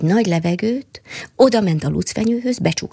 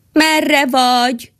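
louder, higher and drawn-out call while climbing; mole calling out; 7 semitones higher, 4 dB louder, much slower than usual. Acted. Mole calls to the squirrel while climbing blind, a slow, loud, higher call. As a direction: call slowly upward into the unknown. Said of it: "kiabálta a vakond".

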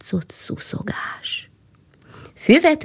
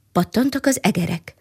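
plain reading voice

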